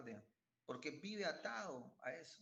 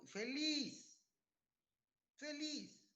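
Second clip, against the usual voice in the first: exclamación muy aguda y alegre; grítalo como celebrando, muy agudo; feliz; 12 semitones higher, 3 dB louder, much slower than usual. Acted. Predicador remeda la alegría del pecador. (burla exaltada de la felicidad del pecador)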